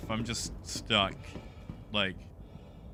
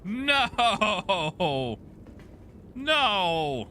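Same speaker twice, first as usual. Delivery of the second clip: dismayed shout; 7 semitones higher than usual. Real, not acted. Something goes wrong in game.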